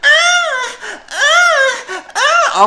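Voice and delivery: very high, loud mock moans; loud moaning. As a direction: imitate her moaning hella loud. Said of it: "she's moaning, like, hella loud".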